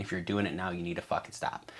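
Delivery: urgent whisper. Real, not acted. Urgent warning to viewers.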